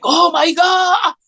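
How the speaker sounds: high, slow drawn-out cry of delight; excited teen. Retold or imitated. Teen fan meeting the actor, high slow excited cry. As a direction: stretch it out like a thrilled kid. He got, like, crazy.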